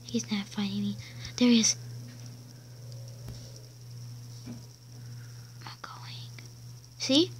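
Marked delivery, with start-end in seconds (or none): 0.0-6.3 hushed whisper while hiding. Real, not acted. Hiding from a player, whispering quietly. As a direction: whisper so he won't find you.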